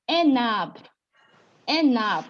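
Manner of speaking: "enab" said loudly as joined en-nab; teacher's emphatic "enab", falling, N carried over; liaison demo. Shown as a demonstration. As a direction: model "enab" with the N linked onto ab. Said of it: "it gives us".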